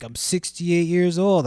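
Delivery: high, put-on voice for the buyer; older man voice. Music stand buyer explaining his drum lessons, imitated. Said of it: "He was like".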